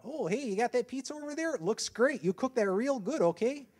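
high, loud exaggerated Wisconsin accent; hammed-up Wisconsin character voice. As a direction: tease the boss in a put-on Wisconsin accent. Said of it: "talk back and forth in that accent".

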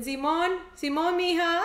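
a sing-song Mexican "Simón, Simón, mija", laughing; playful attempt at a Mexican accent; imitating a Mexican accent. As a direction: try out the Mexican lilt and giggle. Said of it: "me parece súper gracioso cuando dicen".

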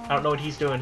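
ordinary talk, nothing marked

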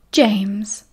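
mock-grave address; solemn, measured. A narrator's reading. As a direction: say the name with great solemnity. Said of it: "in a very solemn voice".

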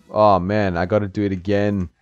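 ordinary talk, nothing marked

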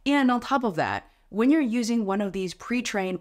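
normal talking voice

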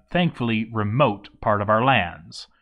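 ordinary delivery, nothing marked

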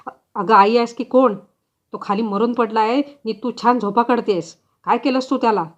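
loud, accusing husband yelling at his wife; angry husband. Acted. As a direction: shout it at her in shock and fury. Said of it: "हलवून उठवले आणि म्हणाला". (रागाने ओरडणारा नवरा)

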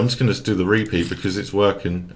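normal talking voice

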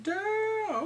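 high, long drawn-out mock swoon; admiring damn. Voicing girls admiring Podrick.

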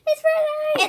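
high squeal. Real, not acted. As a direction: squeal like grabbing ice. Touching frozen egg.

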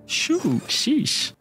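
high falsetto, falling at the end; high-pitched echo. Retold or imitated. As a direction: mimic the little sound way up high. Echoes high squeaky game sound.

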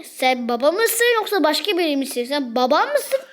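ordinary delivery

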